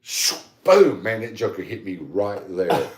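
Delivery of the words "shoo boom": loud, higher whoosh then boom. Retold or imitated. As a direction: shout the rocket hit like a sound effect. Imitates rocket hitting him.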